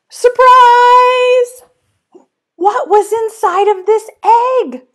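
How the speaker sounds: high, loud gasping "Surprise!"; big storybook reveal; excited surprise. Acted. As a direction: burst out with delight for the kids. Alligator reveal.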